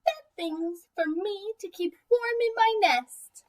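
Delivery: a bird fussily claiming the thing; little bird. Acted.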